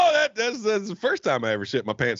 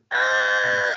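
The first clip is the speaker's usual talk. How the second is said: tire screech sound; 8 semitones lower than usual. Acted. Acted skid noise.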